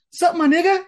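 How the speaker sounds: high-pitched, breezy imitation of a greeting; imitated greeting. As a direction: toss it out high, like a young coworker. Imitates young coworker's casual greeting.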